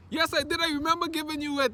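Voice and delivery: high, loud squabbling retort; acted insistent rebuttal. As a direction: fire back in a mock argument. Acted argument, teacher insisting.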